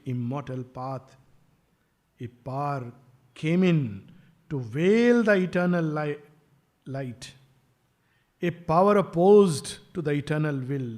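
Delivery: slow, measured recitation with pauses; solemn verse reading. Acted. Speaker reading scripture-like verse to listeners, slow solemn recitation. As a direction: read the verse out slowly, phrase by phrase. Reads out lines of verse slowly.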